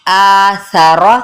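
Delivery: आ सा रौ with only आ held long; stretched आ. Shown as a demonstration. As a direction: hold आ one alif long, keep सा रौ short. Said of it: "आ को खींचेंगे एक अलिफ के बराबर". (आ को खींचें, सा रौ नहीं)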